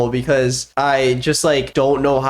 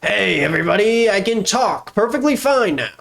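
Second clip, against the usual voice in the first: a higher, cheerful puppet voice; 7 semitones higher than usual. Acted. The puppet greets everybody after the cut, higher. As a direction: pipe up as the puppet. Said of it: "movie magic".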